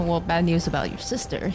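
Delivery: ordinary talk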